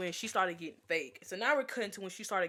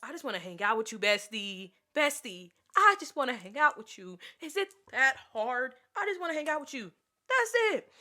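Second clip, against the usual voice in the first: rising exasperated plea, higher and louder; pleading; 6 semitones higher, 4 dB louder than usual. Retold or imitated. Pleading with her bestie to hang out. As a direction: beg her friend, building to a high whine. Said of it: "And I'm sitting here like".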